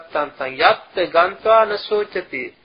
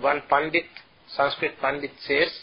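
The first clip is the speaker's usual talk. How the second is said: ordinary talk, nothing marked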